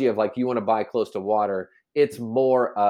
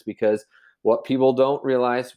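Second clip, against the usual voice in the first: ordinary talk, nothing marked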